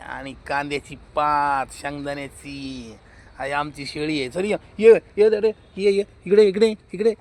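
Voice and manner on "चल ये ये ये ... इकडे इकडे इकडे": loud high calls to a goat; beckoning an animal, raised voice; calling the goat. Real, not acted. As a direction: call her over across the yard, loud and high. Calling the goat over loudly.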